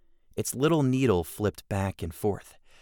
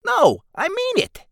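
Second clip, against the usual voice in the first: goblin voice; 8 dB louder than usual. Acted. Goblin character line.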